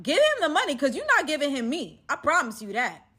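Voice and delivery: loud, high defiant vow; defiant shout. Retold or imitated. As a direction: stand your ground, shouting. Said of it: "so I'm just like".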